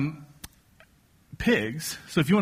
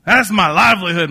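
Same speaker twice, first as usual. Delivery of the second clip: furious shout; 6 semitones higher, 8 dB louder than usual. Retold or imitated. Preacher voicing the British man yelling at the farmer, furious. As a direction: bellow it like a wronged pig owner. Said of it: "guy was furious".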